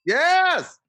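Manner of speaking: high, loud burst of excitement; very enthusiastic. Real, not acted. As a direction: shout it out with joy. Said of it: "That was very enthusiastic".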